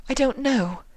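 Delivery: delicate hazy voice; Rarity, dazed. A narrator's reading.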